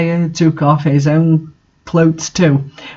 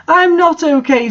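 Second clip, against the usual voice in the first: high hammed-up wail, much higher; depressed scream; 11 semitones higher than usual. A narrator's reading. Draco answering Raven, a high mock scream. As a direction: cry it out, melodramatic despair. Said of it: "screamed depressedly".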